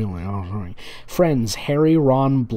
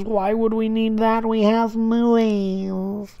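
higher, slowing right down on we have movies; sarcastic mock question; 9 semitones higher, slower than usual. Real, not acted. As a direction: drag the last words out in mock innocence. Mocking the story's lack of description.

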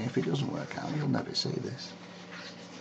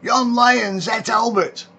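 mother hollers Yon lion's et Albert high and loud; hollered; 12 semitones higher, 6 dB louder than usual. Acted. Mother hollering to the crowd, loud Lancashire cry. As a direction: shriek it out in Lancashire accent. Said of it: "she hollered".

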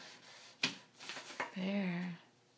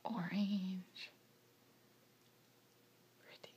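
whisper; 2 semitones lower, 12 dB quieter, slower than usual. Real, not acted. Mother whispering while child paints.

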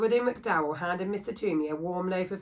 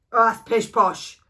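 much louder, slow scornful scoff; gruff woodcarver; 3 semitones higher, 11 dB louder, slower than usual. Acted. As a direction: dismiss the child's idea out of hand. Woodcarver scoffs at happy sheep.

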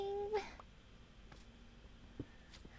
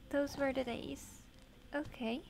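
ordinary talk

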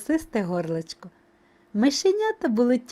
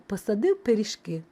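ordinary delivery, nothing marked

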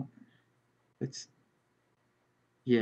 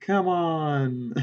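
ordinary delivery

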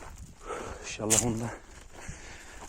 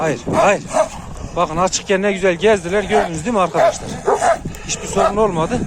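loud, raised voice over the scuffle; real frustrated shouting; exasperated shout; 7 semitones higher, 9 dB louder than usual. Real, not acted. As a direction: yell to be heard over barking dogs. Yelling over dog fight.

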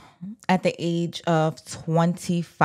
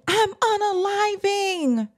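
half-hearted mock yell, much higher; retold yell; 13 semitones higher, slower than usual. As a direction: imitate her crying out, pitched way up. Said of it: "she's yelling".

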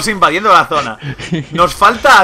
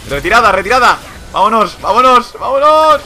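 higher, louder, rising urgent calls; shouting orders over battle; 7 semitones higher, 5 dB louder than usual. Real, not acted. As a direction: bark retreat orders to the team. Calling retreat mid fight.

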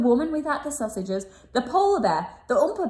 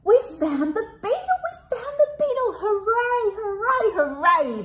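cheering shout; 11 semitones higher than usual. Acted. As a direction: cheer like a happy crowd. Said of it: "they shouted".

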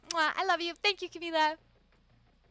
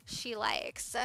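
whisper; 6 semitones lower, 8 dB quieter than usual. Real, not acted. Secret bread question aside.

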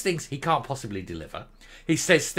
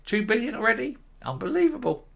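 Unbelievable said in mock shock, pitched up; mock disbelief; 8 semitones higher than usual. Shown as a demonstration. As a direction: feign amazement at Unbelievable. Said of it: "it's not unbelievable".

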